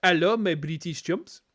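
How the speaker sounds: slow French presenter accent, imitated; mock French accent greeting; hammed-up French accent. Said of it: "he is actually French, but just hammed it up".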